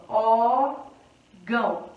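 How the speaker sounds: ÓRGÃO split slowly into ÓR, pause, GÃO; órgão very slowly, broken after the ÓR; syllable by syllable. Shown as a demonstration. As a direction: draw out órgão in two separate syllables. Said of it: "Temos aqui, ó".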